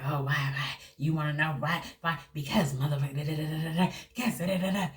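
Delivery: mock-imitation of a sneering mother. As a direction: throw the question back in mockery, tailing into nonsense syllables. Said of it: "be mocking you".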